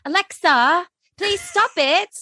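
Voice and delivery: put-on high British accent politely begging Alexa. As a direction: beg sweetly in a British accent. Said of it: "when I use a British accent".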